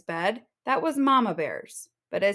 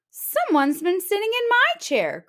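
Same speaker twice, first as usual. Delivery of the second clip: high crying out, stress on my; a higher mother's cry of dismay; Mama Bear crying out over her chair; 11 semitones higher, 5 dB louder than usual. Acted. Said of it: "cried Mama Bear".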